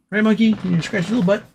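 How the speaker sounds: sing-song pet voice; pet talk. Real, not acted. Talking to pet in baby voice.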